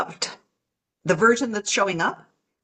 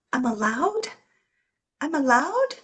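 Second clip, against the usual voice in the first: 1 stunned disbelief; 6 semitones higher than usual. Pretend. Inner version stunned by permission.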